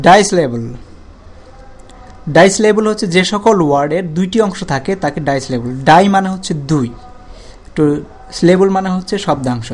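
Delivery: ordinary delivery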